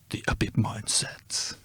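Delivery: whispered dramatic voiceover; horror trailer voice. Acted. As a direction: whisper it like a motivational video. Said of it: "I see a motivational video".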